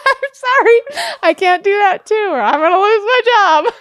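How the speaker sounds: high, loud, laughing sorry; laughing apology. Real, not acted. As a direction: blurt it out through laughter.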